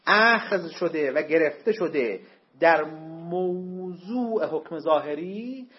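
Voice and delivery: very slow, each word of چون شک در حکم واقعی laid out; teacher slowing down on a key line; slow, weighty delivery. Shown as a demonstration. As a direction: spell out the key sentence slowly for students. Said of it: "این جملات خیلی مهمه".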